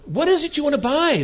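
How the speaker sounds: put-on high, loud old sheep asking; a storybook sheep asking what Alice wants to buy, high and loud. A narrator's reading. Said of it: "the sheep said at last".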